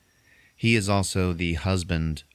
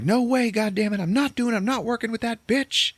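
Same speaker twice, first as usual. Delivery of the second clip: squeaky mock fury. Willie refusing to work with her, squeaky mock fury. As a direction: shriek out a refusal in mock rage.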